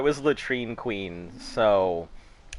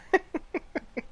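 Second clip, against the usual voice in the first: breathy high laugh; 6 dB quieter than usual. Real, not acted. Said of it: "Oh, fuck, it was wonderful".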